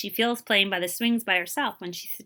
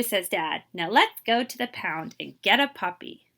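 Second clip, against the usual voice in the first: lively, expressive picture-book reading; teacher reading a storybook to young kids; reading to children; 5 dB louder than usual. Acted. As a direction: read it aloud to a class, bringing each line to life. Teacher reads picture book aloud.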